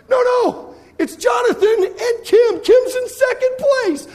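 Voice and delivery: high, loud, slow call; stunned announcer calling the comeback; excited announcing. Retold or imitated. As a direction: shout the surprise standings. Announcing Kim's surge.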